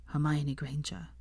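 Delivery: broken whisper of her name; voice cracking. Acted. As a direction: voice gives out on the name. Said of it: "His voice cracked".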